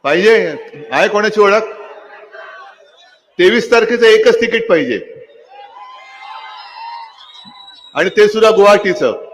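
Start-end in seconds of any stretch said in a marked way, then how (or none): 3.4-9.2 rally shout. Acted. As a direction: shout the jab to the crowd. Mocking punchline to crowd.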